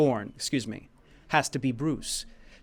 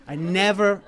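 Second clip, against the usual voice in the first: ordinary talk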